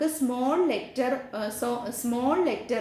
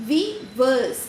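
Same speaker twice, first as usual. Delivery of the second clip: "we verse" with "verse" hit louder; stress on verse; 4 dB louder than usual. Shown as a demonstration. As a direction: lean on the second syllable "verse". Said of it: "verse എന്ന് പറയുന്നിടത്താണ് stress". (Verse കടുപ്പിച്ച് ഉച്ചരിക്കുക)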